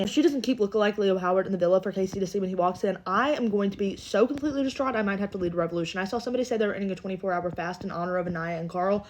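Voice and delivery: ordinary talk, nothing marked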